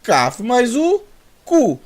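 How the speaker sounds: cu spelt out high and slow as kaf mais u, then cu; teacher sounds out kaf mais u into cu for Portuguese learners; Hebrew letter demo. Shown as a demonstration. Teacher spelling cu aloud for the class, slow and clear. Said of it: "ao falar cu".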